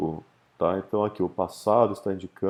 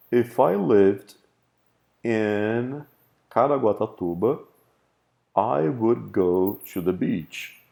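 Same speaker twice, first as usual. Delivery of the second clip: slow example read; slower than usual. Shown as a demonstration. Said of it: "teacher reads example sentence".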